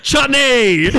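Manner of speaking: high, loud yell of a nickname; mock shouted taunt. Pointing at a posh bloke in a dead-silent room, taunting yell. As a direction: yell it out high, imitating a heckler.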